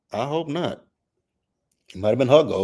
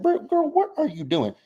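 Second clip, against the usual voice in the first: mock shriek, much higher; high incredulous. Vlogger mocking Brit in a high incredulous squeal. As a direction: squeal it out in disbelief. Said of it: "But I was like".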